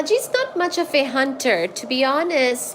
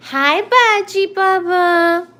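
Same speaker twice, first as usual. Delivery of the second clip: cat greeting; 6 semitones higher, 4 dB louder, slower than usual. Real, not acted. Greeting her cat in baby talk.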